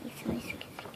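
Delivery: soft giggly whisper. Acted. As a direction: whisper it like a secret. Comment after kissing noise.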